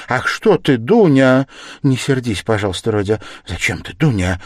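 loud, flustered mother's appeal. A narrator's reading. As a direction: plead anxiously to smooth things over. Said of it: "заговорила в смущении Пульхерия Александровна".

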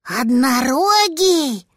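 put-on high, slow, marveling childlike voice; little dragon voice. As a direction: draw it out in wonder.

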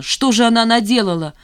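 high, loud dismayed cry; shouting. A narrator's reading. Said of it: "закричала Жанна". (крик, громко и высоко)

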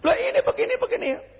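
a preacher mocking a cornered opponent in a high voice; flustered critic.

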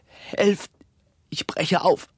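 high, strained cry for help; dying victim's breaking cry; desperate plea. Acted. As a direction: gasp it out in terror, pitch leaping. Dying plague victim calls for help.

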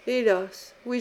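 ordinary delivery

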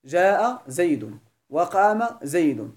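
جاء زيد drawn out slowly, each example spaced apart. Shown as a demonstration. Teacher reciting example sentences to class, slowly drawn out. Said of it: "تقول".

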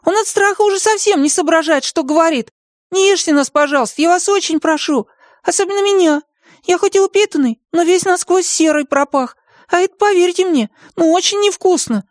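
very high, squeaky begging; frightened pleading. A narrator's reading. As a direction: plead for your life in a tiny high voice. Martyn begs dragon not to eat.

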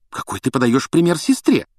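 high-pitched scolding question; indignant. A narrator's reading. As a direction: take him to task like an outraged mother. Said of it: "возмутилась миссис Уизли".

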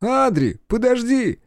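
put-on high, pleading young boy; a little brother begging him to wait; Joel calling. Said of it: "Джоэль".

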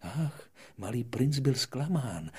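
plain reading voice

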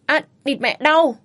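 husband yelping as his arm is bent back; cry of pain. Acted. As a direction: howl with the arm twisted. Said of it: "Mạnh đau".

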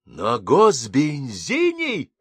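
slow, high, indignant cry; outraged disbelief, drawn out; officer outraged the driver used state fuel; shocked exclamation. Acted. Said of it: "вскричал Тараканов".

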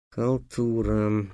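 soft, slow sighed word; sighing. Acted. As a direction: sigh it out in disgust. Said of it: "вздохнул Гавар". (со вздохом)

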